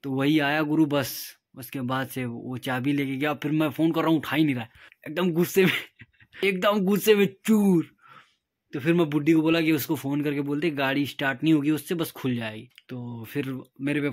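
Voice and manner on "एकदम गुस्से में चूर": laughing. Real, not acted. Laughing to viewers about how angry his friend got. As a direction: repeat it through a real laugh.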